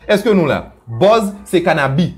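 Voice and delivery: ordinary talk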